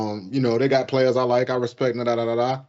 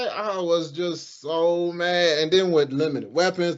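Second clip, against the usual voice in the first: usual speaking voice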